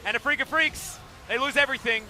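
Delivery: loud, rising hype call as the fight breaks out; excited play-by-play. Real, not acted. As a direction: shout the teamfight as it happens.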